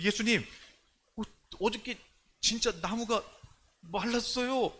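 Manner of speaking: mock-astonished disciple telling Jesus; excited report. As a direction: blurt it out as if just seen. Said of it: "놀래가지고 흥분하고".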